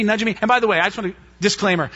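ordinary delivery

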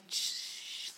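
long, soft shushing sound; hushing shh. Acted. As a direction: hush someone with a drawn-out shh. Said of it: "It's just like".

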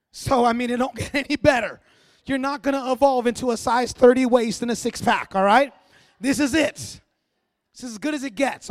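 loud preacher punching every line to the room; full-force preaching. Acted. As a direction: preach it out loud and hard, almost teasing. Preaching loudly at congregation.

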